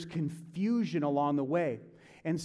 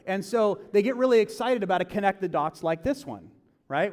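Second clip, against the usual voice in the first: ordinary talk, nothing marked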